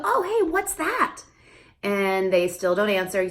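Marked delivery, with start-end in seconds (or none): 0.0-1.2 high slow parentese question; high singsong question; playful question to child. Shown as a demonstration. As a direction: coax the toddler to answer. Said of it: "You go".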